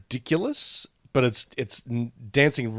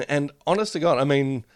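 ordinary talk, nothing marked

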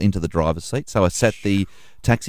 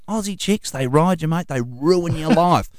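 mimicking the passenger; 7 semitones higher than usual. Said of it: "But New Zealand women".

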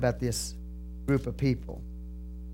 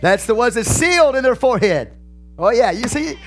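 loud, high, heated pulpit voice; fired-up preaching; 7 semitones higher, 6 dB louder than usual. Acted. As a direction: lift the congregation with rising fire. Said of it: "I start talking like this".

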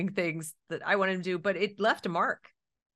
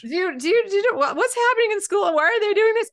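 high, loud stammered questions; retold alarm, pitched way up; alarmed child imitation; 13 semitones higher, 7 dB louder than usual. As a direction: act out a flustered kid pleading for answers. Retells alarmed kids asking mom.

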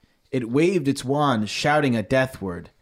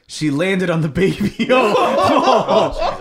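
laughing while reading; 3 semitones higher, 6 dB louder than usual. Real, not acted. Reading a grim line, giggling.

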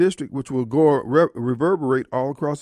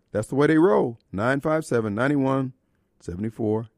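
lower, steady sponsor read; radio ad read; 3 semitones lower than usual. Acted. Radio host reading a pharmacy ad, lower and steady. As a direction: pitch the drugstore to listeners.

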